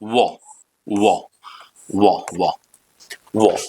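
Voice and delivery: वो repeated low with oval lips; sound drill. Shown as a demonstration. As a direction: drill वो slowly, lips rounded. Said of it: "आप ऐसे पढ़ेंगे". (होंठ गोल करके "वो" का अभ्यास)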